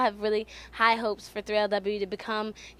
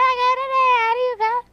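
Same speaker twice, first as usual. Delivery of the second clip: put-on teasing old-person voice, high and slow; old people voice; 13 semitones higher, 7 dB louder, much slower than usual. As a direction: copy the old lady voice back, high and drawn out. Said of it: "They're like".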